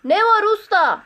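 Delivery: high, loud call from far off; muffled distant answer. A narrator's reading. Sotiri answers his master from afar, muffled distant call. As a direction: call back as if from far away. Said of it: "çok uzaklardan boğuk boğuk cevap verdi".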